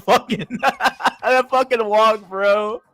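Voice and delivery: high-pitched laughter breaking through the words; can barely get it out for laughing. Real, not acted. Streamer cracking up at the memory of the walk, laughing. Recalling Rom Wills' funny walk.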